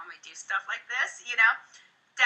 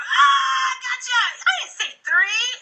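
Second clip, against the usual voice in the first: much higher and louder, laughing; playful gotcha; 13 semitones higher, 5 dB louder, slower than usual. Shown as a demonstration. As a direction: catch them out with glee. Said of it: "I go".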